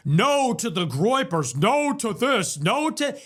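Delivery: a sneering put-on voice, lightly done; a mild mock of the pundit's delivery; mocking Shapiro. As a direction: parrot the rival's slogan back with scorn. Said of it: "He said".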